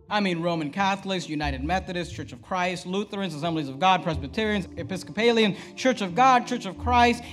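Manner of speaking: usual speaking voice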